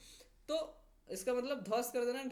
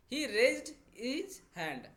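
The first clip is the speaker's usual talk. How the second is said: very slow and louder; slow dictation read; 3 semitones higher, 5 dB louder, much slower than usual. Shown as a demonstration. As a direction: dictate it slowly and clearly. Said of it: "उसने अपने हाथ को ऊपर उठाया".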